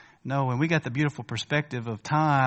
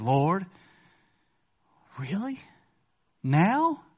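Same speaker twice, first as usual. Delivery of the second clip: incredulous rising "really? Now?"; exasperated prayer; 4 semitones higher, much slower than usual. Retold or imitated. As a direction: plead with God in disbelief.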